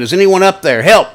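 loud, high call upward; desperate shout; calling for help. A narrator's reading. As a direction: yell up to anyone above. Ben calling up the cliff.

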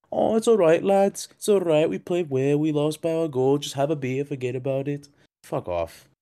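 hammed-up Manc accent, cheery and dismissive; bad Manc accent. Mocking the coach consoling lads after a loss in a bad Manc accent. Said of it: "one of the worst Manc accents".

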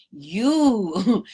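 usual speaking voice

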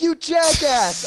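insulting a YouTuber over his soda ranking; mock outrage. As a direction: yell at the screen in fake fury. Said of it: "screaming and laughing at this dude".

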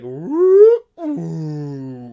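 a high, loud imitated slide-whistle whoop; mock whistle sound. Podcaster mimicking the film's silly whistle effect, loud high whoop. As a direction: squeal the cartoon whistle effect, then drop. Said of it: "you're like".

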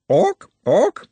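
high, sharp repeated cry; bird-like squawk of one word; squawking bird creature. Acted. The sisters cry at Nut, squawking.

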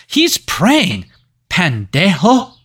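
a high, slow, hammed-up Mexican accent on the retort; mock Mexican accent. Podcast host quoting the post office scene, mock Mexican accent. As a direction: snap back in a cartoon Mexican accent. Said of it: "not trying to come off as racist by doing this accent".

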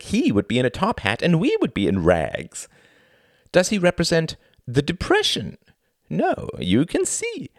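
high, showy stage-host voice; theatrical thin man. Acted. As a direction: play the pompous thin performer lecturing the audience. Thin man explains the metaphor.

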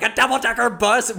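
high, incredulous mock yell; imitated yell. Rick reacts to Jonathan's choice of transport, high mock yell. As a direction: exclaim it high, in comic disbelief. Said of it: "when he yells".

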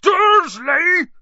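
huge, high, drawn-out bellow; explosive giant's roar; booming shout. A narrator's reading. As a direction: boom the name in fury. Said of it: "he boomed".

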